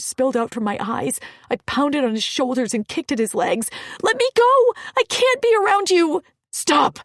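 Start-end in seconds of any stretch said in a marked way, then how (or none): 4.0-6.2 high frantic yell; desperate shout. Acted. Pounding on his shoulders. 6.5-7.0 growl. Acted. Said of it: "he growled".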